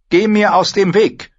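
high, loud, cutting dismissal; angry command. A narrator's reading. Queen shoves past the pleading knight, angry. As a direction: order him aside forcefully.

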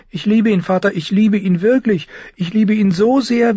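high, loud declaration of love; fervent girl. Acted. Sansa persuades father of her love, fervently pleading.

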